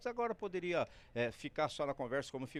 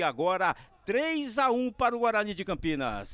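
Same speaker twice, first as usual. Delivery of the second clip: excited co-commentary; 7 semitones higher, 7 dB louder than usual. Real, not acted. Co-commentator excited after goal.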